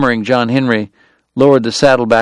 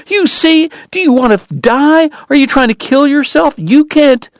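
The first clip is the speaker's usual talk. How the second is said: much higher, loud tearful pleading; frantic weeping; 3 dB louder than usual. Acted. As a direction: beg him not to kill himself. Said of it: "weeping and frantic".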